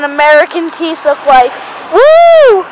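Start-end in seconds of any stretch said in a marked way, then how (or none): 1.9-2.7 high, loud woo of delight; excited whoop. Real, not acted. Showing teeth to viewers with an excited whoop. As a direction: cheer it out, thrilled.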